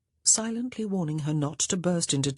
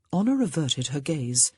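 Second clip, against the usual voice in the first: ordinary delivery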